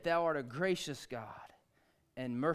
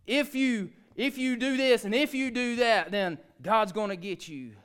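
high, loud mock warning of doom; a hammed-up threat that God will get you; mock hellfire threat; 8 semitones higher, 9 dB louder than usual. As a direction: sneer it like a scare-tactic preacher. Mocking a threatening preacher.

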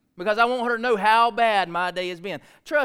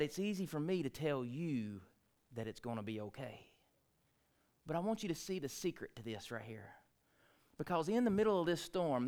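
ordinary delivery

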